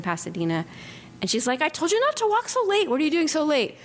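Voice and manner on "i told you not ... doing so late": much higher voice, a worried daughter nagging; scolding daughter. Retold or imitated. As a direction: play the daughter telling mom off, pitched up. Daughter scolds her on phone.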